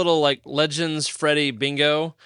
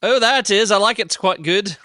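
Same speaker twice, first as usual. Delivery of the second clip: loud, higher-pitched British; hammed-up British approval; mock British accent; 5 semitones higher, 6 dB louder than usual. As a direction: put on an emphatic British accent. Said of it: "Can we play it in British accents".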